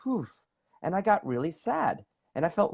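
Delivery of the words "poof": soft, higher puff of sound; imitated poof. Showing energy suddenly vanishing, soft poof. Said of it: "all of a sudden it went".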